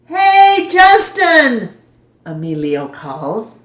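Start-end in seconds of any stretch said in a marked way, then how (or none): 0.1-1.7 much higher, loud playground call. Acted. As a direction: yell across the yard. Emilio calls across the playground.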